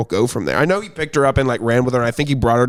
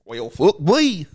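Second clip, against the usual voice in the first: slow, higher cartoon dog with a Southern twang; Goofy; 4 semitones higher, slower than usual. Shown as a demonstration. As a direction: drawl it like a goofy cartoon hound. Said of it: "my Goofy impression lately".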